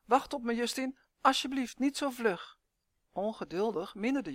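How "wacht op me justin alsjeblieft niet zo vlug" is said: higher-pitched pleading whine; plaintive wail. A narrator's reading. Elf left behind pleading with boy, wailing. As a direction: beg to be waited for, high. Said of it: "jammerde Uf".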